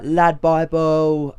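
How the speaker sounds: London accent impression. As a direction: stretch it slowly in a mock London accent. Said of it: "I can't do his accent at all".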